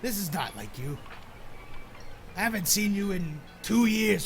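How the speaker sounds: loud gruff seadog scolding; gruff old fisherman. Acted. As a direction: chide him like an old shipmate. Sailor NPC scolds friend.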